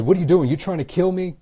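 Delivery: gasping man yelling at his attacker; shocked outcry. Retold or imitated. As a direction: cry out at the man holding you under. Drowning man shouts in shock.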